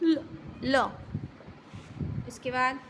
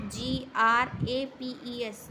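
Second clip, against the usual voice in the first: slow spelling; much slower than usual. Shown as a demonstration. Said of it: "grapes में आप क्या बोलते हो".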